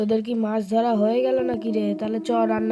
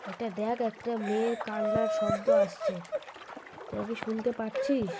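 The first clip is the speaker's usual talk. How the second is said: softer, uneasy hush; hushed and wary; 6 dB quieter than usual. Acted. Friend hears a girl crying by the river, hushed and uneasy.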